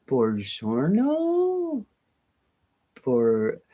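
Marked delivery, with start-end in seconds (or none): 0.1-1.8 Buongiorno drawn out slowly, rising high then falling; trying Italian. Shown as a demonstration. Viewer's Italian greeting tried out slowly. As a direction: test out Buongiorno slowly with a big swoop up. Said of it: "isn't the G a J".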